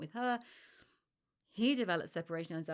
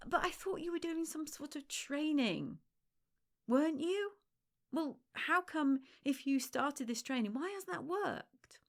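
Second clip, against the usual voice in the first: much higher, faux-puzzled voice ending in a whisper; mock smug friend; 7 semitones higher than usual. Smug friend questioning failed training, mock puzzled. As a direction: needle her with fake innocence.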